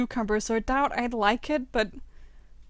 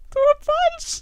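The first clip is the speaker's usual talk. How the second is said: much higher, louder, outraged reading of the option; shocked exclaim; 5 dB louder, slower than usual. Real, not acted. Gamer shocked by the violent option, exclaiming.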